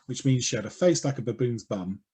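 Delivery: ordinary talk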